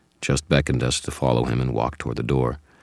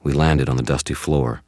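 plain reading voice

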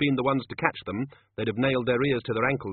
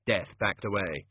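plain reading voice

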